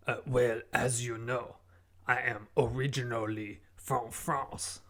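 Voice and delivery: put-on guest in a bogus French accent; fake French accent. Guest answering the host, in a fake French accent. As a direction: lay on a made-up French accent. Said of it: "What is that accent that you're doing?".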